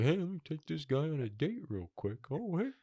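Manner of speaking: high, soft flirty bear; a lovestruck bear cooing, high and soft. Pretend. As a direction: coo like a bear with a crush. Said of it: "this bear is like".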